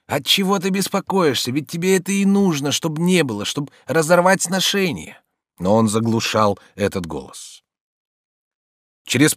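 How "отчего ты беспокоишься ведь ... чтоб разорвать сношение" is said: high, urging secret voice, not really whispered; an inner voice coaxing, pitched up; insinuating inner voice. A narrator's reading. A secret voice talks the master out of worrying, high insinuating murmur. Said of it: "Тайный голос тут же шептал ему".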